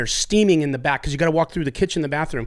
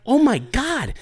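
mock shock; 8 semitones higher, slower than usual. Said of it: "I'm like".

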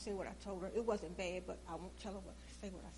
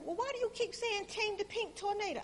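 daughter's voice; 11 semitones higher, 5 dB louder than usual. Retold or imitated.

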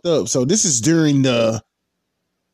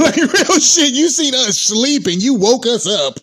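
laughing protest; 10 semitones higher, 4 dB louder, slower than usual. Real, not acted. Laughing while blaming the man.

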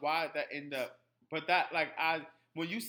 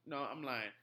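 normal talking voice

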